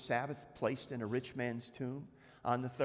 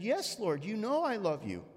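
earnest reply; 7 semitones higher than usual. Acted. Peter answering Jesus.

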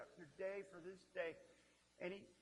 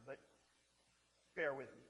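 usual speaking voice

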